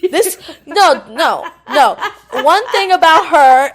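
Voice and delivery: loud, high, laughing protest; real laughter. Real, not acted. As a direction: object while cracking up. Cracking up over friend's key chart.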